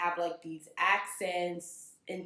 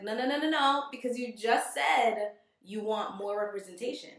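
loud, higher, exasperated pushback; exasperated retort; 5 semitones higher, 4 dB louder than usual. Retold or imitated. Scolding a colleague about race, louder and higher. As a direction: push back hard, fed up.

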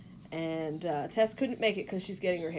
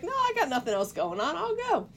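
high, sing-out volunteering; breezy insistence; 10 semitones higher than usual. Retold or imitated. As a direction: offer yourself lightly, voice lifting at the end. Retold eager offer to tag along.

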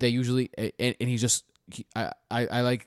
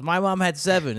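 mild mock yell; 6 semitones higher, 4 dB louder than usual. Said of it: "This one guy just yells at me".

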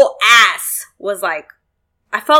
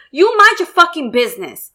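higher-pitched irritated telling-off; retold pissed-off snub; 6 semitones higher than usual. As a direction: re-enact putting a meddler in their place. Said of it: "I was so pissed".

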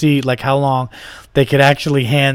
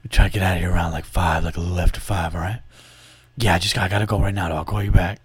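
lower, softer office phone voice; hushed, hurrying off the call; work voice; 6 semitones lower, 4 dB quieter than usual. Shown as a demonstration. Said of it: "try it one more time".

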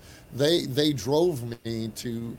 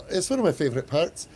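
put-on Scottish accent; 6 semitones higher, faster than usual. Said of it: "forgive my poor Scottish accent".

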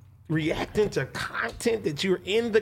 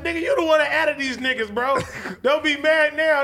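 mock scolding; 9 semitones higher than usual. Retold scolding of a friend.

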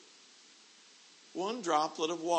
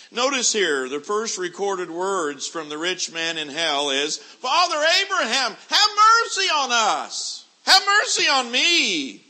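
high, loud hellfire sermon voice; full-force preacher warning of hell; fiery preaching; 12 semitones higher, 13 dB louder than usual. Acted. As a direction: thunder it down from the pulpit.

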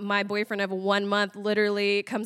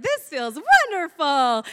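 slow, higher-pitched mock bliss; 4 semitones higher, 4 dB louder than usual. Stoned friend making snow angels on the carpet, blissed-out. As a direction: savor it in a raised, drawn-out voice.

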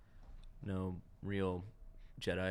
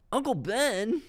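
high, loud mock whine; whiny Luke; 13 semitones higher, 8 dB louder, slower than usual. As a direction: whine it like a petulant young hero. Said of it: "when he's whining the first scene".

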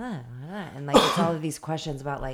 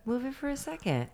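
squeaky mock voice; 12 semitones higher, 6 dB quieter than usual. Acting her own grumpy morning self.

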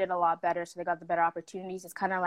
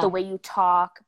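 mocking quote; 7 dB louder, slower than usual. Mocking what people said.

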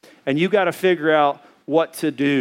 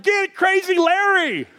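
much higher, slow, shouted command; mock shout; slower than usual. Urging restaurant patrons to grab Larry, high slow shout. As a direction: egg the rescuers on.

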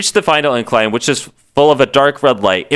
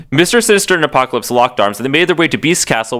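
ordinary talk

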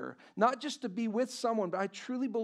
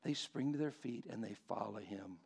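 soft, lower, reverent preaching; hushed preaching; 7 semitones lower, 8 dB quieter than usual. Acted. Pastor to congregation on Bartimaeus, hushed and reverent. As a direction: draw them in with a near hush.

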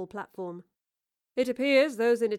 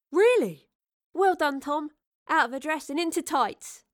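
high, loud burst of delight; bubbly, excited, pitched up; delighted congratulation; 7 semitones higher, 6 dB louder than usual. Acted. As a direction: cheer a friend's good news. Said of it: "Astrid laughed and hugged him".

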